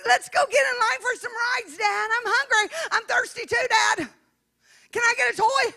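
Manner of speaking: put-on high-pitched eager child pestering Dad; excited little girl. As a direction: beg like a kid at the fair. Preacher acts herself as little girl.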